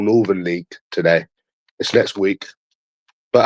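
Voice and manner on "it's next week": ordinary delivery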